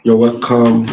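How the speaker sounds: loud, bright on-air welcome; loud greeting. Acted. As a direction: call it out to the audience.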